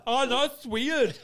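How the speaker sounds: high, loud, drawn-out mock disbelief; slow, laughing squeal of disbelief; incredulous. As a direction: imitate a weirded-out friend, half laughing.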